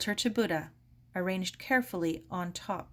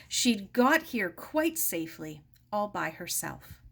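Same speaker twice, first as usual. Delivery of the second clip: usual speaking voice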